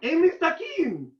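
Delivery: mock-pleading retold apology; high placating voice. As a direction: insist you are harmless in a high voice. Showing the lady he is harmless.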